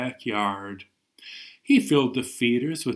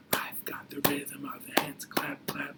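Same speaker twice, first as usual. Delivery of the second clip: soft unvoiced whisper of the chant line; whispered echo; 13 dB quieter than usual. Teacher repeating the rhyme for kids, whispering. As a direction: hush it right down to a whisper.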